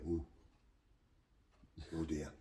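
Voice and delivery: low, soft imitated "oh dear"; hushed dismay. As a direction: mimic a crowd's quiet, daunted reaction. Crowd's hushed reaction to the boxer.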